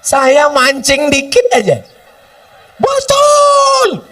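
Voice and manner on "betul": very high, squealed yes; falsetto shout. Real, not acted. Preacher squealing agreement to bait the crowd, high.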